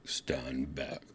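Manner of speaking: very soft, low whispered robot. Retold or imitated. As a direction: whisper it like a faint background machine. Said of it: "this robot voice going".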